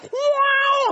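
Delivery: high-pitched cheer as the band appears; crowd's wow. Acted. Concertgoer as the headliner takes the stage, high excited cheer. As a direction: let out a long, high whoop of awe.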